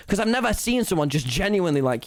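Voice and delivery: normal talking voice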